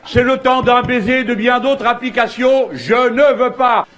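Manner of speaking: pompous declamation, every word stretched out; mock-solemn refusal. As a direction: ham it up like a wounded dramatic hero. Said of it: "j'espère que ça vous a fait marrer".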